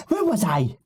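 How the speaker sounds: put-on high, sing-song childlike creep; high character voice. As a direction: chirp it like a giddy little creature. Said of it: "and he goes".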